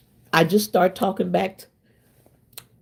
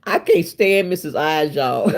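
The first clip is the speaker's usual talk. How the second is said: laughing tease. Real, not acted. Teasing a commenter, laughing.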